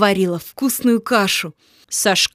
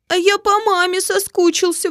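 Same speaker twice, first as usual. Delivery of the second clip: exclaiming; 8 semitones higher than usual. Acted. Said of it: "воскликнул Коля".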